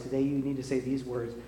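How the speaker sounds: ordinary delivery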